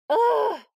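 loud shrill frustrated ugh; frustrated scream. A narrator's reading. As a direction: let out a fed-up scream. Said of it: "Nick screamed".

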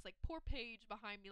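ordinary talk

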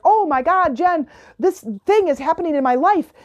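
hammed-up dramatic distress; a hint of a frantic client bursting out; mock shocked client. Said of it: "completely in this shocked, traumatic, uh, dramatic state".

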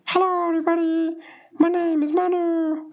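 very high squeaky voice. Shown as a demonstration. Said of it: "I can speak, like, really squeaky high".

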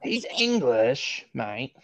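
a hint of a mock British accent, slow; lightly put-on British accent on mate; bad British accent. Teasing friend with a bad British accent. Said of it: "You have such a bad British accent".

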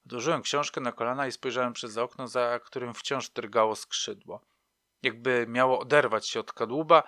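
plain reading voice

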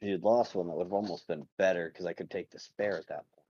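low, quiet deadpan, no hint of a laugh; straight-faced deadpan. Prisoner tells his captors he'd take their spare lizard, straight-faced. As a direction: say it softly and completely seriously. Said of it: "He says that with a straight face".

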